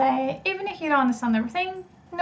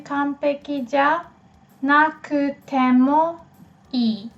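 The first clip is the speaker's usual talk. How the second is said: broken into pieces. Shown as a demonstration. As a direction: sound out 完璧じゃ, なくても, いい piece by piece. Teacher builds phrase in pieces.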